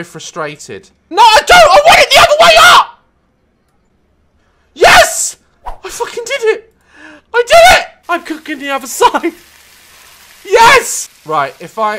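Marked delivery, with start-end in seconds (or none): 1.1-3.0 high, loud, fast near-crying shout; frustrated shrieking. Real, not acted. Gamer shrieking at the steak flipping wrong, near tears. 4.7-9.3 high, loud victory yells with a laugh; triumphant cheering. Real, not acted. Gamer cheering after finally flipping the steak, elated. 10.4-11.1 triumphant yell. Real, not acted. Triumph in game.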